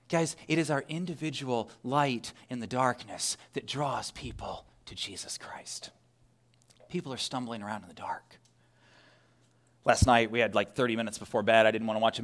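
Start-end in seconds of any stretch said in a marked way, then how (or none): 0.1-8.4 very soft, low, near-whispered appeal; hushed preaching. Real, not acted. Preacher telling the congregation how light draws people, hushed. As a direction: let it sink to a solemn hush.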